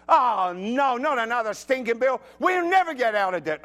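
high, loud mock wail; despairing complaint. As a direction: moan in hammed-up despair over money. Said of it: "get another bill and go".

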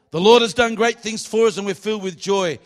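loud, high-pitched scripture proclamation; preaching. Real, not acted. Preacher proclaiming a psalm to the congregation, loud preaching voice. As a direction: declare the verse out over the congregation.

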